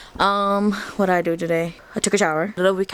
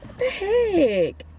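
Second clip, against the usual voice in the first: higher, slow stretched what the heck; slow delighted disbelief; drawn-out surprise; 10 semitones higher, much slower than usual. Real, not acted. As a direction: stretch it out in amazement. Reacting to cute character.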